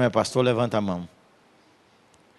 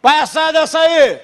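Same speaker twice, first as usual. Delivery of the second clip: even higher, slow full yell; louder shout; 11 dB louder, slower than usual. Retold or imitated. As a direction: bellow the same order harder. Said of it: "Gritei duas vezes".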